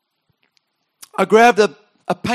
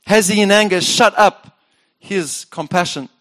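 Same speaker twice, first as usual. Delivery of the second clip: psalm reading. Acted. Pastor reading Asaph's lament, solemn. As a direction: read the lament with weight.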